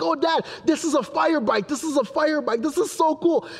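high-pitched imitation of a thrilled son; happy little boy. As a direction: play a delighted kid showing Dad. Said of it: "he was so happy".

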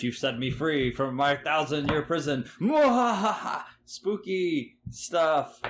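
hammy villain freed from prison; mad scientist. Pretend. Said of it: "I'm trying to get into the season".

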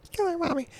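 put-on creepy child murmuring to mother; child's voice. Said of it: "she says in a child's voice".

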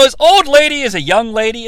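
ordinary talk

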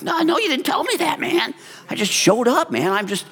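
mock panic. Scared preacher answering the pastor, squeaky mock panic. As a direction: play your younger self, panicked and pleading. He recalls being scared and unprepared.

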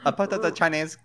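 botched put-on Chinese accent; bad Chinese accent. Co-host botching British into a mock Chinese accent. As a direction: slip into a fake Chinese accent.

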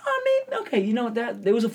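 high-pitched sheepish soldier act; excuse-making soldier. Acted. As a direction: lightly play a soldier brushing off a defeat. Imagined soldiers excusing first lost battle.

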